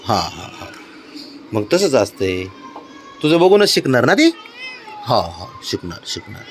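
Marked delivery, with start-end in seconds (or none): 5.1-6.4 low, soft, gentle murmur; calm him down kindly; tender coaxing. Real, not acted. Father soothing the child.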